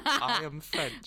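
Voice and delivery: slow, drawn-out mock British accent; mimicked British accent. Teasing a friend over "I am French", mock British accent. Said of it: "with a British accent".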